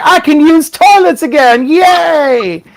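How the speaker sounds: high, squealing joy over the toilet; giddy relief. Retold or imitated. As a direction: celebrate like a kid set free. Acting out joyful relief.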